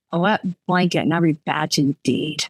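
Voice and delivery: usual speaking voice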